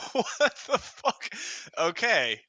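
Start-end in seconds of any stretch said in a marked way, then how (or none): 0.1-1.3 high, slow shocked exclamation; stunned disbelief. Real, not acted. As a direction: drag out the disbelief. Shocked at roll.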